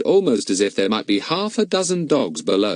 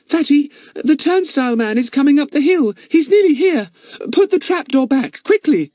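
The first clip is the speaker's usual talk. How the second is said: high, louder urgent warning; frightened girl; 6 dB louder than usual. Acted. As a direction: hurry them before he arrives. Betts warns, frightened.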